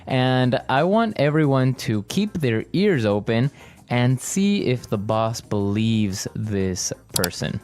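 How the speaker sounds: usual speaking voice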